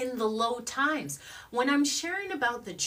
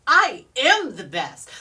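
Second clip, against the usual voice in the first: loud, slow, emphatic declaration; heartfelt affirmation; 3 semitones higher, 12 dB louder than usual. Shown as a demonstration. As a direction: proclaim it, landing hard on each word. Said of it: "Feel it".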